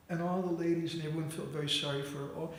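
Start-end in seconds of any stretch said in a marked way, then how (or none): none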